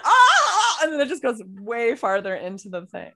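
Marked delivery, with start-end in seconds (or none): 0.0-0.8 high laughing shriek; mock shriek. Mom shrieking at her daughter's way of talking, laughing shriek. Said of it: "she's like".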